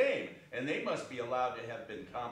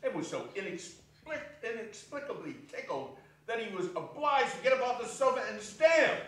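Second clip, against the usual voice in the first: excited narration; 9 semitones higher, 6 dB louder than usual. A narrator's reading. Narrating nephew's laughter.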